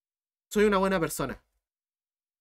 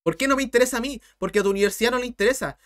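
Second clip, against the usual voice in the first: normal talking voice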